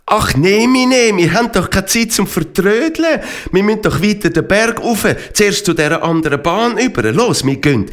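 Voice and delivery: high-pitched, mildly impatient hurry-up; impatient urging. A narrator's reading. Impatient character urging the others on.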